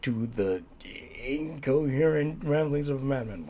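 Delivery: high, upbeat show-host intro; show intro announcer. Acted. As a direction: announce the channel name with flair. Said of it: "I really need to work on that intro".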